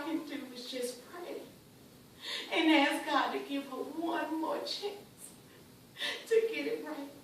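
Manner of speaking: soft, drained and sorrowful; hushed, helpless prayer; defeated resignation. Acted. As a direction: let the fight go out of you. Mother resigned after outburst.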